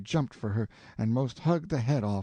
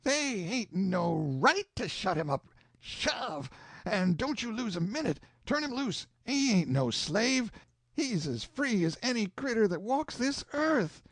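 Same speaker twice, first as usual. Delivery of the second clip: higher, calling out urgently; 8 semitones higher than usual. A narrator's reading. Tom calling out from bed, urgent and fierce. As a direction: demand they set him loose now. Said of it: "sings out to me".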